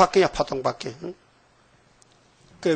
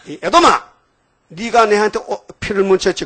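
꾸짖는 외침; 5 semitones higher than usual. Acted. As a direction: call Edom out sharply, half-acted. 예수님 말씀을 재연. (에돔을 꾸짖듯 높게 외침)

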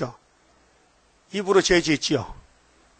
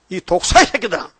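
lightly acted rebuke, one word punched up; attacking rebuke; 2 semitones higher than usual. Preacher voices Jesus attacking the Pharisees, mildly acted rebuke. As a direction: imitate a denouncing attack on opponents. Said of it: "예수가 공격하거든".

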